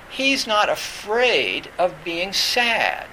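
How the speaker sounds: usual speaking voice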